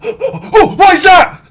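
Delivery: high-pitched cry of revulsion; appalled yelp at a gruesome sight; horrified squeal. Real, not acted. Horrified reaction to video.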